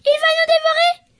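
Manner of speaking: high, loud panicked shriek; piglets screaming. Acted. Piglets spotting the wolf, high terrified scream. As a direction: shriek for help in terror. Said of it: "hurlèrent les cochonnets".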